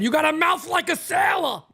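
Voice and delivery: high, loud shout; dad yelling at mom about her swearing; imitating dad's shout. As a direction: yell it like an exasperated dad. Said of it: "so many times going".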